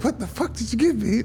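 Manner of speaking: high, surprised mom voice. Retold or imitated. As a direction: squeak it out like a panicked mom. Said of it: "I was like".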